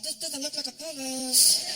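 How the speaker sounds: slow doubtful drawl; suspicious. Retold or imitated. Comic doubting the palace gates, slow. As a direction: eye it with doubt. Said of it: "I'm like".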